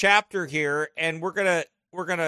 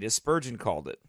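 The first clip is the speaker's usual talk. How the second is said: usual speaking voice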